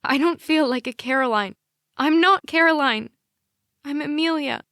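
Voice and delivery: high, loud sobbing protest; tearful outburst. Acted. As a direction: cry it out, pitch climbing. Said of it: "and I sob".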